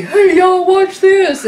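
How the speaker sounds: put-on high, loud drunken show-off call; drunk Bones showing off. Imitating drunk Bones showing off to the crew, high and loud. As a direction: holler it like a tipsy daredevil. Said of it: "you may be".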